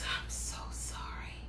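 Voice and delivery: slow, whispered apology, imitated. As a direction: whisper it like an embarrassed stranger apologising. Imitates apologetic lady pulling up.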